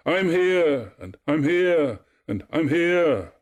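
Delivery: high, loud repeated echo; ringing calls, each one falling away; crying out. A narrator's reading. Echo repeats Narcissus's words, crying out high. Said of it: "she cried".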